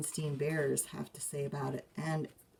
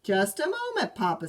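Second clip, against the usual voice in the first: stern mother; 5 semitones higher, 6 dB louder than usual. Acted. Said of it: "said Mama".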